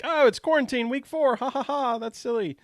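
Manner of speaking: high, lilting, lighthearted mock amusement; mock naive cheer. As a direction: play the blissfully naive early days. Mimicking naive early pandemic cheer.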